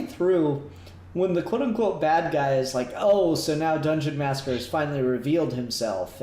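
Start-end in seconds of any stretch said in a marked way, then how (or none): none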